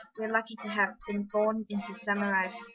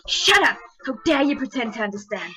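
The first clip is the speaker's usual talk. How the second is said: screaming shout; 3 semitones higher, 11 dB louder than usual. Acted. Swordsman screaming at the man who sees through him. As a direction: explode in fury. Edisai explodes.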